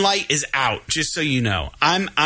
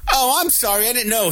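high-pitched imitation of an apologetic customer; mock apology; 6 semitones higher than usual. Customer behind me apologizing, mockingly imitated.

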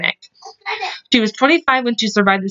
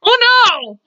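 real startled cry; 13 semitones higher than usual. Real, not acted. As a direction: blurt it out in shock. Reacting to her slip.

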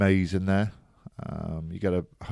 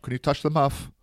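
mock commentator; 7 semitones higher than usual. Quoting someone commentating.

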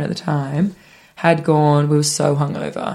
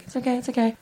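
higher, soft reassuring murmur; soothing boyfriend; 7 semitones higher, 8 dB quieter, faster than usual. Retold or imitated. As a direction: comfort her gently like the boyfriend did. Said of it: "And he's like".

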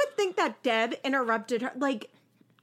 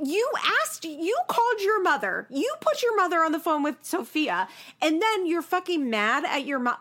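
exasperated rant; 7 semitones higher than usual. Retold or imitated. Own exasperated reaction.

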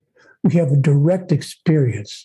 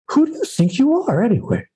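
high-pitched imitation of a challenging father; father's challenging question; 8 semitones higher than usual. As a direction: put the question like an exasperated dad. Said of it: "he asked me periodically the same question".

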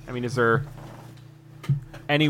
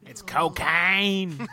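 pirate voice; 6 semitones higher, much slower than usual. Acted. Pirate in-character reveal.

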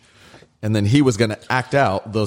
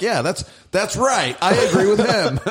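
amused agreement; 11 semitones higher than usual. Retold or imitated. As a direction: act out delighted agreement. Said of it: "I was like".

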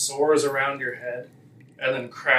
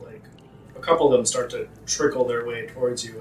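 normal talking voice

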